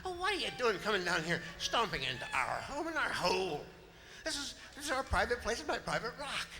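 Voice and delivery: high-pitched, fussy rabbit telling off an intruder; put-out little critter, rattling on; indignant rabbit. Acted.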